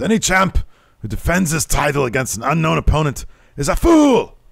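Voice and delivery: N=Gunther/public; mock Gunther. Quoting Gunther's promo.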